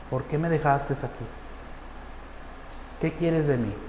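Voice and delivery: soft, falling questions, spaced apart; retold plea to God. Prisoner asks God why he was left, hushed retold plea. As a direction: quietly put the questions to God.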